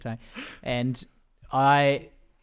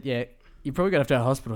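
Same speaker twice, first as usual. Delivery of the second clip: fast retelling; much faster than usual. Retold or imitated. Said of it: "they said".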